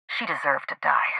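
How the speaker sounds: whispered, cold and final; a chilling whispered verdict. Acted. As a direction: hiss the verdict under your breath. Confessing the killing, cold.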